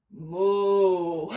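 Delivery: low gentle cow moo. Pretend. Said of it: "lower your belly and say".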